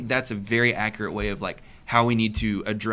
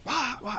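mock baby wail; 12 semitones higher, 6 dB quieter than usual. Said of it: "he wasn't screaming like".

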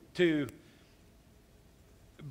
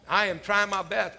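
normal talking voice

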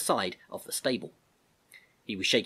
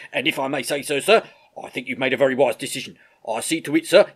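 louder, higher, respectful sergeant; approving army sergeant; 4 semitones higher, 9 dB louder than usual. Acted. Sergeant praising the Major's decision, hearty and respectful.

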